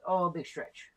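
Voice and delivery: ordinary delivery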